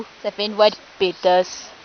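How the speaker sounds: deep voice. Shown as a demonstration. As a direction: drop the voice low as a bloke. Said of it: "quand je fais la voix grave".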